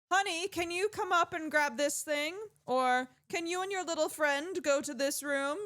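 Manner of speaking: high-pitched mom calling over the house intercom; booming intercom mom. Retold or imitated. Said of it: "her voice would just boom through".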